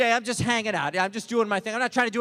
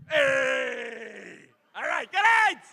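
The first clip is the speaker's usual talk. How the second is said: quick impression of a type; much slower than usual. Comic leaving the crowd with an impression, put-on voice. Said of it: "This is my impression of one of those types of guys".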